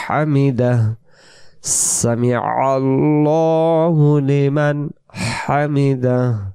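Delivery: slow সামি আল্লাহু লিমান হামিদা with long vowels; measured, sustained prayer recitation; model recitation. Shown as a demonstration. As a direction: draw out আল্লাহু, breathe the h of হামিদা. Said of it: "এমন আওয়াজ আসবে".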